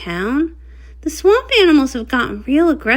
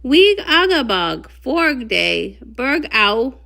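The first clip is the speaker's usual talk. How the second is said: muffled through mask; 4 dB quieter, slower than usual. Acted. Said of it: "The mask makes it hard for ponies to hear me".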